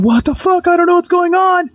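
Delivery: high, panicked imitation of wife; terrified. Scared wife as doors are slamming, high retold panic. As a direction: act out rising terror, pitch way up. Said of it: "she's getting more terrified".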